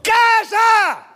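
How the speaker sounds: high, loud rebuke; furious shout; shouted command. Retold or imitated. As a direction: bark it at the storm. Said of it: "le gritó".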